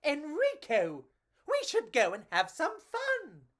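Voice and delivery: high, eager bug voice; little cockroach voice. Acted. Cockroach urging his friend to adventure, high and eager. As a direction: squeak it out like an excited tiny bug. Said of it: "He said".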